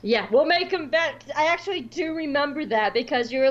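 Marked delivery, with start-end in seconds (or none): none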